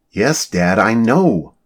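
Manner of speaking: exasperated daughter; a touch of teenage impatience; impatient. A narrator's reading. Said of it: "Sarah was getting impatient".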